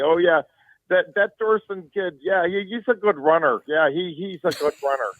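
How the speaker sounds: put-on high-pitched rural Minnesota accent. Local announcer covering a football game, mock Minnesota accent. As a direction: mimic a small-town Minnesota radio announcer's accent. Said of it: "that's what he was talking".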